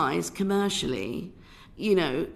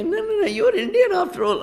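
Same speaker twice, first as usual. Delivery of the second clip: much higher and louder, insistent mock voice; pushy producer; 12 semitones higher, 8 dB louder than usual. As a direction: mimic the pushy producers brushing off her objection. Bollywood producers insisting she's Indian.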